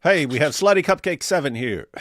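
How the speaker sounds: ordinary talk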